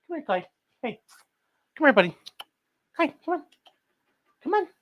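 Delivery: soft high pet-talk, calling the dog over; coaxing a pet. Real, not acted. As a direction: coax the dog gently, sweet and soft.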